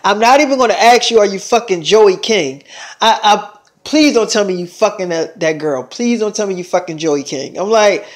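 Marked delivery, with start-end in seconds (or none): none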